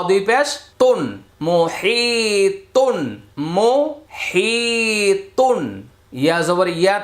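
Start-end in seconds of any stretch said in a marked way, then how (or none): 1.5-5.6 মোহিতুন with a long, held হি; higher, slow recitation of মোহিতুন, stretching হি; madd stretch. Shown as a demonstration. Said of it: "জেরের বাম পাশে জজমলা ইয়া".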